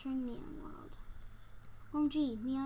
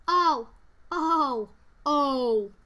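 startled shouts; 12 dB louder, much slower than usual. Real, not acted. Startled by a crash.

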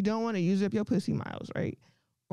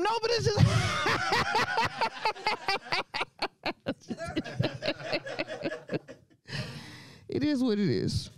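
laughing shout, then settles; 12 semitones higher than usual. Real, not acted. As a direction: burst out laughing mid-line. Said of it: "laughing shout".

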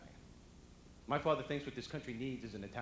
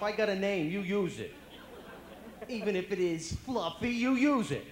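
loud, raised cocky retort; Brooklyn-attitude cat; 6 semitones higher, 7 dB louder than usual. Acted. Cat refusing to fetch, cocky Brooklyn attitude. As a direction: tell the owner off with Brooklyn sass. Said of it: "has a Brooklyn attitude".

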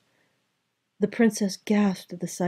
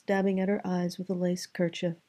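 usual speaking voice

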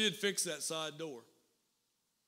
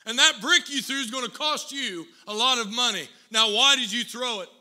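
loud, high scolding that keeps pushing; building angry rant; 4 semitones higher, 10 dB louder than usual. Retold or imitated. Driver berating the boy over his Jaguar, loud fury. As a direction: rage at the dent in his car. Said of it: "he built up a head of steam".

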